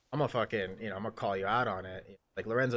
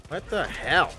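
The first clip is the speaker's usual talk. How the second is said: higher and loud sudden exclamation; startled outburst; 7 semitones higher, 6 dB louder, slower than usual. Real, not acted. Gamer hit in the game, startled and loud. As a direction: react in shock at the screen.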